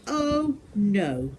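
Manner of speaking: lightly voiced boy recoiling; boy hearing of worm burgers, a mild cry; mild dismayed cry. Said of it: "cried William".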